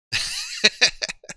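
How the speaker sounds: high, airy real laugh; amused giggling burst. Real, not acted. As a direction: laugh in a light high run. Amused while multitasking on stream.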